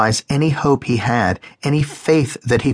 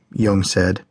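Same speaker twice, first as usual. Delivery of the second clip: ordinary delivery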